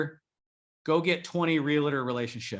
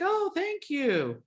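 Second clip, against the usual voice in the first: very high, slow, gushing surprise; surprised receptionist; 13 semitones higher, much slower than usual. Retold or imitated. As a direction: mimic a delighted receptionist, drawn out and high. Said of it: "they get all surprised".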